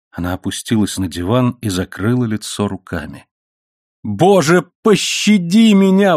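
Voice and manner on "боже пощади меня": high cry for mercy; high, drawn-out anguished plea; anguished exclamation. A narrator's reading. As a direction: cry out to God in agony. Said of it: "воскликнула она, сотрясаясь от рыданий".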